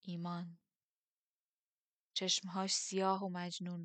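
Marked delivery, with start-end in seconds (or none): 0.0-0.6 softly. A narrator's reading. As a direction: drop it quietly, understated. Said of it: "آهسته گفت".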